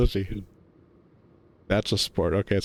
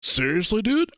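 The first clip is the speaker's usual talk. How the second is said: high incredulous dude; can't believe it, pitched up; 7 semitones higher than usual. Acted. Carl baffled by his friend, high incredulous.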